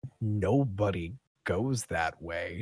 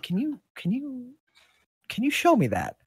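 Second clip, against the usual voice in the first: put-on higher-pitched, curious young worker's voice; game character Alice; 9 semitones higher than usual. Timid worker asking Goliaths about their greeting, higher voice. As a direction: ask hesitantly in a higher voice. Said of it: "she's like".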